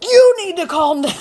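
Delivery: high, loud mock screech; hammed-up angry squawk; mad retort. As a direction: shriek it back, breaking into a laugh. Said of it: "makes you even more mad where you're like".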